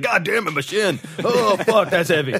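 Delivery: high, loud yelps of pain; hammed-up pain yelp. Batman yelping after kicking the heavy table. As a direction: cry out, clutching the shin.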